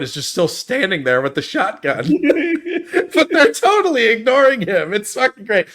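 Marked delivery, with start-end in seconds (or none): 2.1-3.1 high burst of laughter; a real high laugh. Real, not acted. Delighted by the scene. 3.1-5.7 high voice through laughter; delighted and laughing; laughing while talking. Real, not acted. Laughing while retelling scene.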